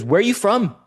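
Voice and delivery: high-pitched mock challenge; imitated interrogation. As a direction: demand it like a rowdy stranger. Imitates stranger questioning him.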